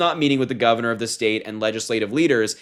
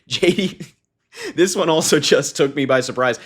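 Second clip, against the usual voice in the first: higher, laughing on the words; real laughing; 4 semitones higher, 3 dB louder than usual. Real, not acted. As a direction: start reading and dissolve into laughter. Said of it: "I'm laughing at some".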